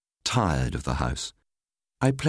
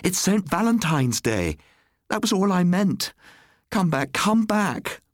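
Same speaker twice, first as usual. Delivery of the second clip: high desperate calling out; wife calls after her departing husband, desperate; crying out; 14 semitones higher, 3 dB louder than usual. A narrator's reading. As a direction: call after him as he walks away. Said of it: "she cried".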